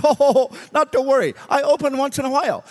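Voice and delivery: high, loud carefree cabbie; breezy driver. Retold or imitated. Driver shrugging off the preacher's fear, high and carefree. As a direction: toss it off with a laugh.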